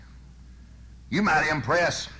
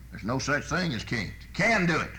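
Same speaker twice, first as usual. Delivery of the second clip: ordinary talk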